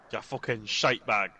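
mock Scottish insult, drawn out; slow put-on Scottish swearing; Scottish accent. Gamer insulting a mate in fun, in a mock Scottish accent. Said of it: "That was Scottish".

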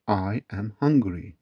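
"I am hungry" slowly, word by word; slow teaching model. Shown as a demonstration. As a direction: spell out "I am hungry" slowly for learners. Teacher gives slow full form again. ("I am hungry" yavaş, tane tane)

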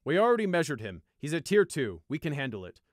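loud, authoritative captain; firm rebuff. A narrator's reading. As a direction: state it firmly, no argument allowed. Captain firmly rebuffs the warning.

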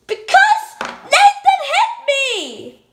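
put-on squeaky little girl tattling; high, giggly tattletale voice; high child voice. Child doll voice complaining.

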